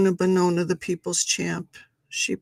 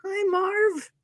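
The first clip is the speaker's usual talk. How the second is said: very high squeaky pet voice; 13 semitones higher than usual. Real, not acted. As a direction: squeal hello to the cat. Greets the big cat.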